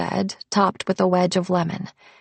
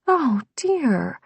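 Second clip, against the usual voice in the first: higher-voiced concerned murmur; lightly voiced, higher woman character; 7 semitones higher than usual. A narrator's reading. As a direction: murmur it with gentle dismay. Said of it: "Rose murmurs".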